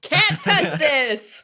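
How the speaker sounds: slow, high, laughing taunt; sing-song gloat; cocky yell. Acted. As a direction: drag it out to mock him. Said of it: "That's what Zan yells".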